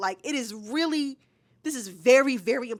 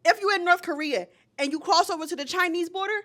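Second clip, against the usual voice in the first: louder, higher, slowing down on send you back; heated emphatic warning; 6 semitones higher, 5 dB louder than usual. Real, not acted. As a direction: drive home the danger. Heated warning about North Korea.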